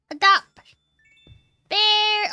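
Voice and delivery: loud eager call; excited shout. Real, not acted. Excited calling out.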